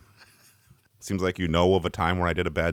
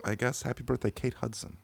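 subdued; 4 semitones higher, 9 dB quieter than usual. Real, not acted. Muted birthday wish.

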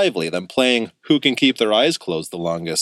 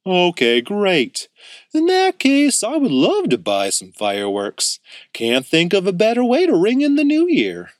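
cheerful uncle; 8 semitones higher than usual. A narrator's reading. Uncle Louie voiced in story.

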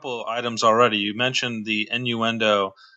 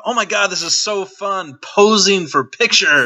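mock-giddy, higher and louder; mock excitement; 7 semitones higher, 5 dB louder than usual. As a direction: ham up a kid's fake thrill. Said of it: "being so overly amped up".

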